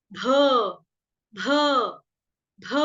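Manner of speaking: भ with a puff of breath, as in भालू; aspirated bha. Shown as a demonstration. Teacher drills the bear's letter, aspirated भ. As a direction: say भ as an aspirated b, each one separate. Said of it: "भालू वाला भ".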